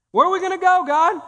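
high, loud plea to God; crying out. Acted. Preacher crying out to God before the congregation, loud and high. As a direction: call out to God in desperation.

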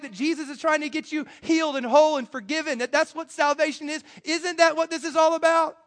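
the healed man pleading with everyone to celebrate; preacher's impassioned voicing of the healed man; exasperated healed man.

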